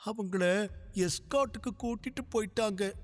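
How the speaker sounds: put-on high child voice, slowing near the end; a small girl sure she is an orphan now; tearful little girl. A narrator's reading. Little girl tells Sarva she has nobody left, tearful child voice.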